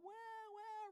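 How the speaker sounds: high-pitched imitated crying wail; imitation of crying two-year-olds; mock toddler wailing. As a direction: wail like toddlers wanting mom, hammed-up. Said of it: "reaching for their moms".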